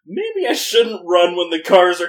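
loud laughing inner voice; laughing mock thought. Narrator voicing the man's thoughts, laughing. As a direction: voice his thought through giggles. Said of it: "he kinda had an inkling of, like".

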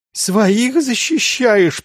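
high, drawn-out tearful cry; slow, anguished high voice; sobbed accusation. A narrator's reading. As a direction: wail the reproach through tears. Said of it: "прорыдал староста".

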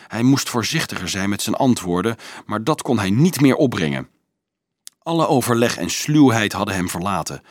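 ordinary delivery, nothing marked

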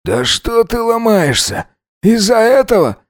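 put-on high-pitched, indignant drunken taunt; jealous goading. A narrator's reading. Tipsy Alex goads his reluctant lover, jealous and scornful. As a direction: push him with a high, scornful challenge.

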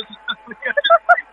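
high laugh. Real, not acted.

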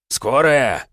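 high, loud barked order; policeman's bark. Acted. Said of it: "гаркнул милиционер".

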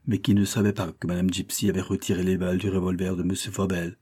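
usual speaking voice

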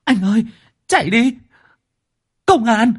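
put-on very high, frantic girl's shriek; shrieking in panic. The girl warns the men that police are coming, shrieking high. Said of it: "nó rít lên".

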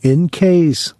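anxious mother pleading; worried old mother. Acted. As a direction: nag him about the reckless driver. Mother insisting.

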